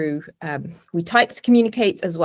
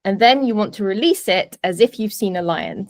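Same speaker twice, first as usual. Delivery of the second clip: ordinary talk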